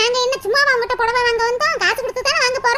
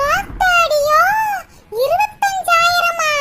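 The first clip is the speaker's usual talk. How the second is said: shocked gasp; 5 semitones higher, 4 dB louder, much slower than usual. Acted. Shocked by the saree price.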